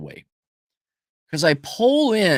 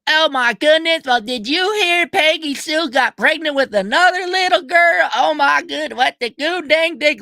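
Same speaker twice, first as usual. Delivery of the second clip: mock Kentucky accent; 12 semitones higher than usual. Mocking the chatty locals in the KFC line, high Kentucky accent. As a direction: ham up the small-town Kentucky gossip. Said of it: "the accent".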